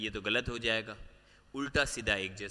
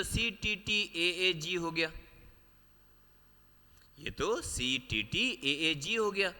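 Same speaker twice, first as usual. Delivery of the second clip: slow spelling out; 6 semitones higher, slower than usual. Shown as a demonstration. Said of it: "अगर मैं GAA ऐसे पढ़ूंगा".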